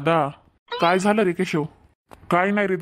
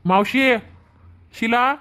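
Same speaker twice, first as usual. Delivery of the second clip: raised calls, pitch going up; calling out; 5 semitones higher, slower than usual. Acted. Brother calling his sleeping sisters and aunt, raised voice. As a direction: call out to wake them in the back.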